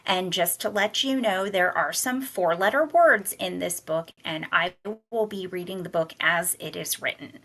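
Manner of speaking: ordinary delivery, nothing marked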